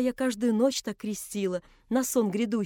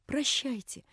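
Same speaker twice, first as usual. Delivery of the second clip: softer, near-whispered goodbye; a hushed parting word, not a true whisper; soft farewell; 2 semitones higher, 5 dB quieter, slower than usual. A narrator's reading. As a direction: let the word barely come out. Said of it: "прошептала Наташа".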